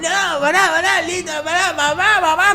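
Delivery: high, loud mock cries for mum; shrieky impression of a kid begging; imitated panicked screaming. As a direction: scream for mum like a beaten boy. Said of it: "Empezó a gritar".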